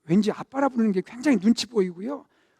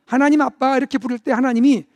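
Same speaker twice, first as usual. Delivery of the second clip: ordinary talk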